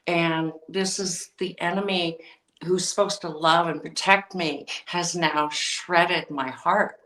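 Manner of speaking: ordinary talk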